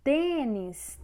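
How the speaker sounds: TÊnis, TÊ loud and stretched; stress on first syllable. Shown as a demonstration. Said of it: "porque é a penúltima sílaba".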